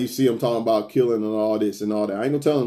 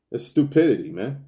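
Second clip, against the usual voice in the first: normal talking voice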